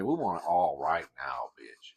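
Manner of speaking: whispered sneer playing the government; mock whisper. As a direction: hiss the demand like a mocking villain. Voicing government's demand.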